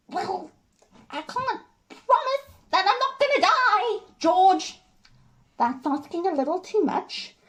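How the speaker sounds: exasperated wife bursting out high; Jenny. Acted. Jenny dares George to leave, heated defiance. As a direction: challenge him, spiking to a loud cry.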